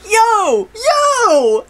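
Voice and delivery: high-pitched excited yo, half laughing; excited mock yo. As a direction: call out like a goofy dancer. Acting out cards dancing in recap.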